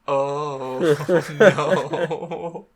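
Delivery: drawn-out mock "oh no" through laughter; mock dismay. Host reacting as Madara joins the fight, mock dismay. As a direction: groan it long, half laughing.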